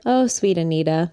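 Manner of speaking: ordinary delivery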